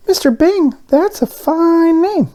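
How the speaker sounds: very high, eager little donut voice. A narrator's reading. Arnie the donut on the tray, excited and high. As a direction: squeak it out with childlike excitement. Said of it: "Arnie decided".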